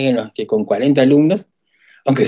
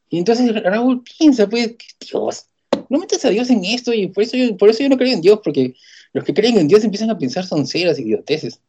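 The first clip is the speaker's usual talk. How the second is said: imitating an exasperated sister, mostly high-pitched; exasperated scolding; 9 semitones higher than usual. Atheist sister tells brother to leave God out, exasperated. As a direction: scold in a raised pitch, dismissing the nonsense.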